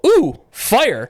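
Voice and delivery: imitating the check-in student spotting fire; mock alarmed student. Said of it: "the student ... go".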